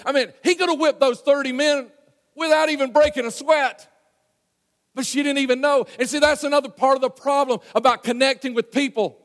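loud, high preacher's climb, pressing the point; rising up to hammer home faith and power; preaching at full force. Real, not acted. Preacher to congregation, loud and impassioned.

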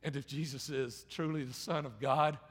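ordinary talk

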